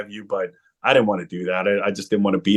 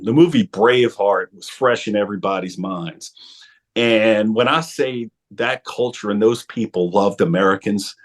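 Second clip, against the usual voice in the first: ordinary talk, nothing marked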